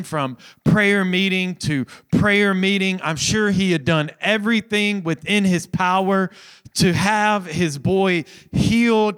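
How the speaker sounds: rising sermon fervour; building preaching. Acted. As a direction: drive the story home from the pulpit. Sermon builds to full force.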